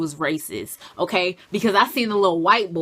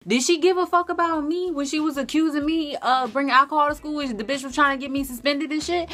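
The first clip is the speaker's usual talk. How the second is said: defiant; 6 semitones higher than usual. Real, not acted. Defiant retelling.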